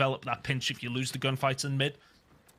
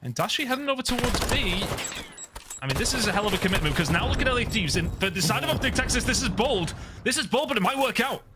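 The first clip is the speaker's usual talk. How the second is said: higher-pitched, urgent caster call; excited play-by-play; 7 semitones higher than usual. Real, not acted. Caster calling a bold commitment over towards B, excited play-by-play. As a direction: build excitement over a risky push.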